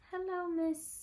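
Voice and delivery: lightly voiced nurse waking a patient; soft nurse wake-up. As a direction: coax a sleeping patient awake. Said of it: "that's how they talk to you when you're sleeping".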